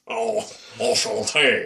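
put-on French accent; mock French accent with drawn-out vowels. Shown as a demonstration. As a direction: exaggerate the French accent for a demonstration. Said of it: "Try to do a French accent".

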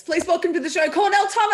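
radio host hyping the guest's entrance; excited welcome. Real, not acted. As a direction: announce him like a big star.

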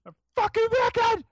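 high, loud screamed outburst; hammed-up enraged yell; mock scream. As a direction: scream it like you finally let go. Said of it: "I'm just like".

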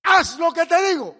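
loud high order from God; angry command. Retold or imitated. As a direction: bark the order from above. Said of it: "se enojó el Señor".

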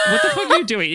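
high, loud what-are-you-doing yelp; mock outburst. Sister snapping at her brother, a loud high mock yell. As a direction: fling it at him in disbelief.

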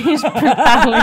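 laughing. Real, not acted. Joke about speaking Leonin.

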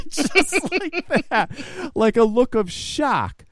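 loud, high burst of laughter into drawn-out words; laughing. Real, not acted. Telling how she reacted to the baby, laughing. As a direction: keep talking through real laughter.

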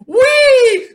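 high squealed whee, loud and sarcastic; mock gleeful whee. Mocking the ambulance attack payoff with a sarcastic gleeful squeal.

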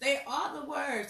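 ordinary delivery

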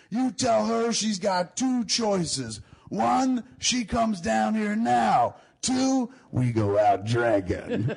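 high, slow shouted demands; drunk ultimatum. Retold or imitated. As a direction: bellow the choices like a drunk. Drunk friend yelling ultimatum.

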